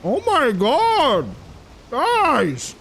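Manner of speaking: high, drawn-out stunned cry; shocked outcry. Real, not acted. Streamer shouting to chat in shock, high and drawn out. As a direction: call out to chat in disbelief. Sudden game event.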